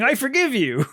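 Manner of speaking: a hint of a lovesick teenager on the phone; lightly voiced teen girl. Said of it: "to her on the phone saying".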